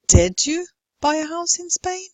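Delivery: Did punched first, then pitch climbing high; stress on DID. Shown as a demonstration. Teacher contrasts sentence meanings, stress on Did. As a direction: hit Did and keep the question rising. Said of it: "differentiate between different meanings like this".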